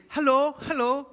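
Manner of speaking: high, loud sing-song hello; God calling out. Retold or imitated. Preacher voicing God calling to people, high sing-song. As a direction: call out brightly like knocking at the door. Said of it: "God is saying".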